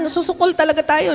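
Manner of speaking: usual speaking voice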